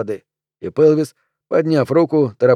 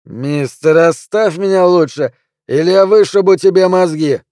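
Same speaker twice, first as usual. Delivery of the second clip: raised, higher angry voice; drunken threat; 5 semitones higher, 6 dB louder than usual. A narrator's reading. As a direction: threaten him loudly, pitch climbing. Drunk man threatens another in bar.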